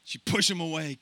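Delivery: ordinary talk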